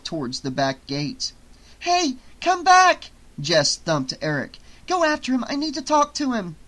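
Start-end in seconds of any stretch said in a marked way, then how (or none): 1.8-3.1 very high, loud, stretched call; high drawn-out shout; calling after. Acted. Jess calls after fleeing man. 4.9-6.6 high, insistent order; pitched-up desperation; urgent pleading. Acted. Jess urges Eric to chase.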